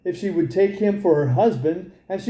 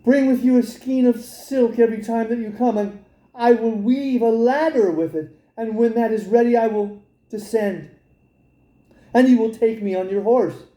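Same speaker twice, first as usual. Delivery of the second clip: put-on lightly higher eager young girl; a young girl planning her escape eagerly; girl's voice; 5 semitones higher than usual. Rapunzel telling the king's son her plan, lightly girlish. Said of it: "She said".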